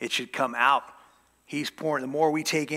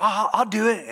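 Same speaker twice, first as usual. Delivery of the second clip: mock eager volunteer; 8 semitones higher, 6 dB louder, faster than usual.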